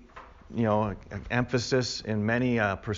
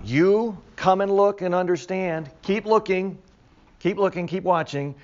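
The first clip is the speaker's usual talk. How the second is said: higher-pitched, insistent urging; urging command; 6 semitones higher than usual. Acted. Creature tells John to keep watching, urging command. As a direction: press each instruction on the listener.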